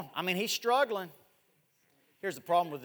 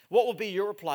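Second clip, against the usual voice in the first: thrown out louder to the whole room; raised preacher's challenge; 3 semitones higher, 6 dB louder than usual. Acted. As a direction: press the congregation for an answer. Question repeated, soft then loud.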